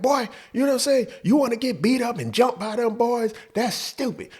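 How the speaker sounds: scolding mom. Retold or imitated.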